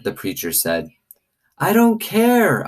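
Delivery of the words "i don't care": high, slow hollered refusal. Acted. Girl hollering back at the preacher, high and slow. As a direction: yell it back, stubborn and hurt. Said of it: "I hollered".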